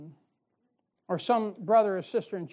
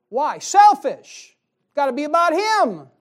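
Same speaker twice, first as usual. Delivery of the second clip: much higher, shouted condemnation; fiery preaching; 9 semitones higher, 14 dB louder, slower than usual. Real, not acted. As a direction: shout the verdict at full force. Preacher denounces Jonah.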